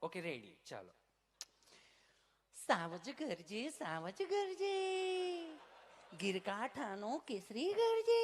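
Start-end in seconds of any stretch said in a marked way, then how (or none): 2.5-8.2 slow, drawn-out sweet poem reading; a dainty teacher recites to kids; mock sing-song recital. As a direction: lilt the lion poem like a nursery rhyme.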